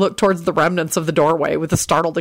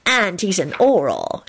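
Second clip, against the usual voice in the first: proud; slower than usual. Acted. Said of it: "he replied proudly".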